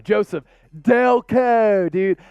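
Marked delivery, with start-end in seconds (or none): 0.8-1.9 "Delco" drawn out in a thick put-on local accent; Delco local pronunciation. Shown as a demonstration. Host shows a viewer the word, "Delco" in exaggerated local accent. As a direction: stretch both vowels of "Delco" the Delco way. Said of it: "the word Delco".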